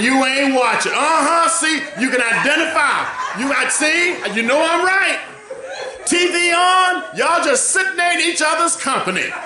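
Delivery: preacher shouting in joy; preaching at full force. Real, not acted. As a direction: lift the room with a ringing call. Preacher rising to whooping shout.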